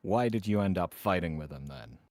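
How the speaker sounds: normal talking voice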